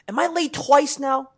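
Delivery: high, incredulous mock panic; disbelief. Late again to the room, high incredulous mock panic. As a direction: voice jumps up in disbelief. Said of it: "I go".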